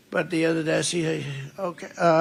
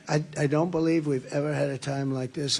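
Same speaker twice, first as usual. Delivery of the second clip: ordinary talk, nothing marked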